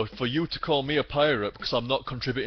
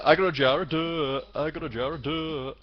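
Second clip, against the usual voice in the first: higher, singsong film-quote imitation; mock singsong taunt; 4 semitones higher than usual. Streamer repeating a pirate film line, mock singsong taunt. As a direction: chant it playfully like a silly pirate.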